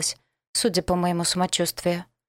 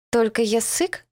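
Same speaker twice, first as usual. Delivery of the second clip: higher, lisping and suspicious; 4 semitones higher than usual. Acted. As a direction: ask warily through a lisp. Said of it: "уточнил он с подозрением".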